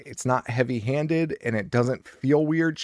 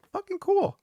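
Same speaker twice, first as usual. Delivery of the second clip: retold reaction. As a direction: quote your own reaction up high. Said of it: "And I was like".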